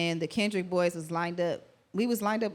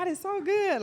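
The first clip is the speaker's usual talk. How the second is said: higher, lightly imitated thankful cry; grateful exclaim; 12 semitones higher than usual. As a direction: marvel at the blessing. Grateful moment before performance.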